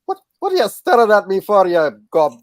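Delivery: hammed-up Scottish, picking a fight; brash put-on Scottish accent; mock Scottish accent. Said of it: "that's my Scottish accent".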